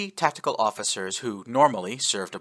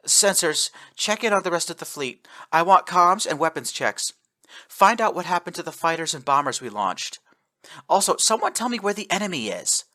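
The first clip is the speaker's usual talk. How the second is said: commanding orders; 8 semitones higher, 3 dB louder than usual. Acted. Captain giving orders.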